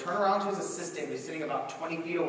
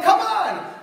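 much higher, louder coaxing; pleading come on; 12 dB louder than usual. Retold or imitated. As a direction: coax him over. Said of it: "And I'm like".

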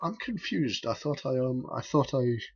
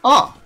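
high, loud sudden yelp; panicked yelp; 12 semitones higher, 15 dB louder than usual. Real, not acted. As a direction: let out a startled cry. Panic mid-battle.

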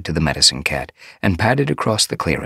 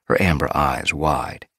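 ordinary delivery, nothing marked

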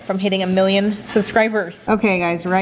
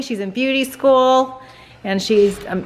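usual speaking voice

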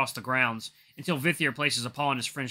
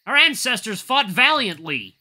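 slow, higher, louder snarl; 9 semitones higher, 8 dB louder, slower than usual. Acted. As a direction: snarl it out defiantly. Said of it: "the female snarls".

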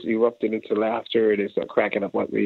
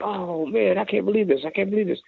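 high-pitched, marvelling repeat; amazed disbelief; 9 semitones higher than usual. Retold or imitated. Randy watching drummer, amazed marvel. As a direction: sound floored by what you hear.

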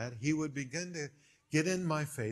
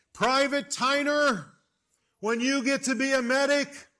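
high, loud barked order; drill sergeant yell; 11 semitones higher, 7 dB louder than usual. Retold or imitated. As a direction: scream it at the private like an angry officer. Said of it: "he yelled at me".

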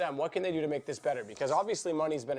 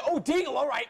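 sudden high hype call; excited; 11 semitones higher, 6 dB louder than usual. Real, not acted. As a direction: jump up for the big shot. Hype call on a Deagle shot.

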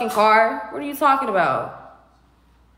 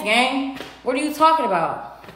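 ordinary talk, nothing marked

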